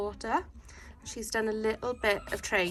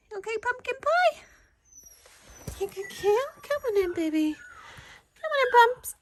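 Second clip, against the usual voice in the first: much higher sing-song coaxing voice; puppy talk; 13 semitones higher, 4 dB louder than usual. Real, not acted. Coaxing puppy outside for wee.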